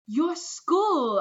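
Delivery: high, loud eager cry; light up at the memory; excited recognition. Acted. Boy sees the tarp school, high and loud with delight.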